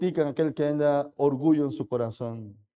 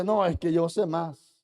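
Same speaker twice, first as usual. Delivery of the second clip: mocking boastful voice; 7 semitones higher, 3 dB louder than usual. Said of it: "El orgulloso dice".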